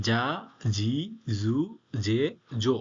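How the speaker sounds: "যা, জি, জু, জে, জো" as separate syllables with buzzing voiced onsets; voiced kana demo. Shown as a demonstration. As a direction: recite "যা, জি, জু, জে, জো" one by one as the voiced Japanese za row. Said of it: "এটার উপর তেন তেন দিলে এটা change হয়ে".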